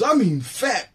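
hamming up the punchline; Rodney joke voice. Retold or imitated. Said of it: "He goes".

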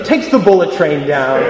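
ordinary delivery

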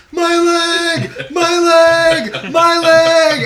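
very high, loud cartoon wail; imitating the clip. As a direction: wail my leg like the SpongeBob clip. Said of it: "all we hear for the next five minutes is".

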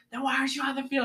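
high, fast angry coach bark. Retold or imitated. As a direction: demand it like a furious coach. Angry coach yelling at player.

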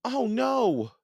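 high-pitched cry of dismay; alarmed shout. A narrator's reading. Annie spotting danger to wildebeests, shouting. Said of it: "shouted Annie".

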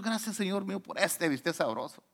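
quiet mealtime prayer. Shown as a demonstration. Preacher showing a prayer over a steak, hushed. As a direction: murmur a grateful little prayer. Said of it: "¿Por qué no oramos".

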